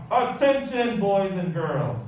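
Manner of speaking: loud, high call for attention; howled announcement. A narrator's reading. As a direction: bellow it over a noisy lunchroom. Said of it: "appeared and howled".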